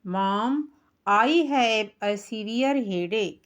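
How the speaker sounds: each English word drawn out very slowly; slow pronunciation demo. Shown as a demonstration. Teacher showing pupils the pronunciation, very slow. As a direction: model every word slowly and clearly. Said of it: "यहाँ उच्चारण देखिए".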